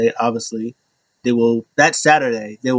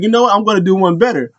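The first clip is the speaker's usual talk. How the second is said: higher-pitched retold excitement; excited; 7 semitones higher, faster than usual. Chief upping his offer to Sammy, excited. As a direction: imitate someone thrilled with a new idea. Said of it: "he's so excited".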